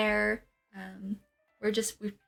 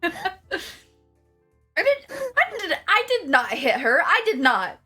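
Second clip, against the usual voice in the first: laughing denial; 6 semitones higher, 4 dB louder than usual. Acted. Denying hitting her, joking.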